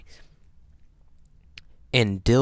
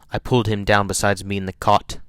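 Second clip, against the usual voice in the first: ordinary delivery, nothing marked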